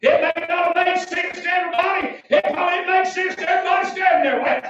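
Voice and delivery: high revival shout; full-force preaching. Acted. Preacher driving home the man's belief, full-force preaching.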